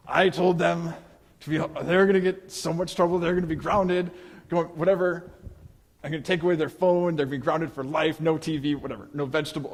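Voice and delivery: put-on pacing and fuming about the late kid; fretting parent rant. Worried parent pacing, ranting.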